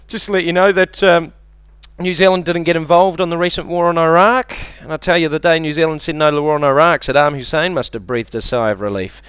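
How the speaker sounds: ordinary delivery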